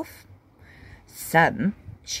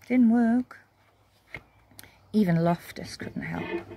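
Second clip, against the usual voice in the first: ordinary delivery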